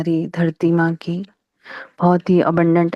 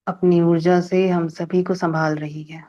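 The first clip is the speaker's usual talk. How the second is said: unhurried phrases with long silences between; guided meditation. Real, not acted. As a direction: lead a calm gratitude meditation, pausing after each phrase. Leads a gratitude meditation. (ठहर-ठहर कर शांत ध्यान निर्देश)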